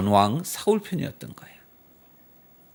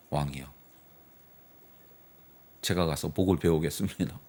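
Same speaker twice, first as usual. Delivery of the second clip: loyal general; 11 semitones lower, 7 dB quieter, faster than usual. Retold or imitated. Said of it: "장군 하나가".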